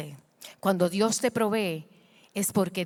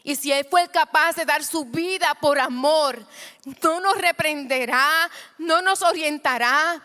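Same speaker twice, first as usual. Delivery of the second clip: loud, high, rising preacher's cry; preaching at full force; 7 semitones higher, 5 dB louder than usual. Acted. Preacher urging the church to give it to the Lord, shouting. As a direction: lift the congregation, louder and higher.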